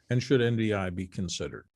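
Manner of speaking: ordinary delivery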